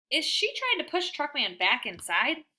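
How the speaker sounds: ordinary delivery, nothing marked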